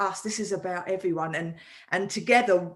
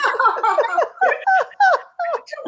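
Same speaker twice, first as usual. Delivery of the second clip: big laugh; 6 dB louder than usual. Real, not acted. Laughing at own story.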